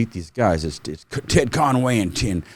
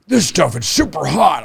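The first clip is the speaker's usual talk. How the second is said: voicing the ammo guy; 8 semitones higher, 3 dB louder than usual.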